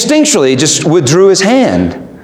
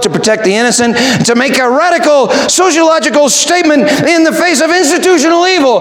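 faster, higher, rising to a mock shout; a sarcastic list that builds to a shout; 7 semitones higher, faster than usual. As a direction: ham up the worldly reasons, climbing. Mocking wrong reasons, building up.

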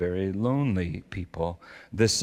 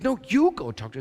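raised-pitch mock bickering; insistent refusal; 9 semitones higher, 3 dB louder, faster than usual. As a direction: toss the job back, acting out a squabble. Acted back-and-forth argument with wife.

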